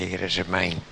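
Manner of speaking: usual speaking voice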